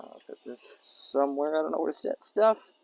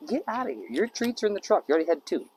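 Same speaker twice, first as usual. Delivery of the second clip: higher scold at the dog; 8 semitones higher, 4 dB louder than usual. Real, not acted. Owner shoos the begging dog from the food, pitched-up scold.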